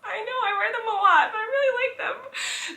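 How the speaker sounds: high squeaky laughing self-mock; laughing reply. Answering a friend's teasing about shoes, high laughing mock voice. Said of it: "And I'm like".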